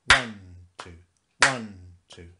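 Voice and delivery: "One" very loud, "two" light; loud stress on one. Shown as a demonstration. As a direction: hit "One" hard like a loud clap. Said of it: "like this".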